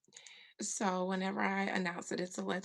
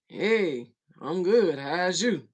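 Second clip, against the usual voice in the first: booming, much louder imitation of granddad; granddaddy's phone voice; 12 dB louder, slower than usual. Granddaddy greeting whoever called, booming imitation. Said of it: "My granddaddy would say".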